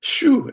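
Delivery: sharp high "shoo" of relief; loud high exhale. Real, not acted. Exclaims at near death.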